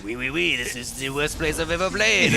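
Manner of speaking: hammed-up French accent; a cheesy fake French accent. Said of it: "French accent".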